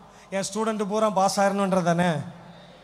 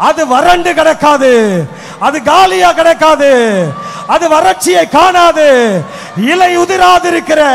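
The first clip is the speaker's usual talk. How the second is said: loud, higher fervent preaching; full-force preaching; 4 semitones higher, 12 dB louder, slower than usual. Acted. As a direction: thunder the promise from the pulpit. (உச்சக் குரலில் பிரசங்கம்)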